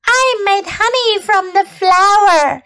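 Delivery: high, squeaky little bee; buzzing insect voice. Acted. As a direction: play a tiny buzzing bee. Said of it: "she buzzed".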